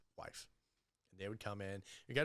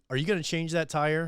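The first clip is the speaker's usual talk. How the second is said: loud, higher, pointed question; loud challenge; 7 semitones higher, 11 dB louder than usual. Retold or imitated. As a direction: call him out loudly. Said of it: "And I get real loud".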